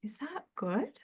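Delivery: quiet, unsure little question; puzzled aside. Retold or imitated. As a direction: ask it softly, half to yourself. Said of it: "And I'm like".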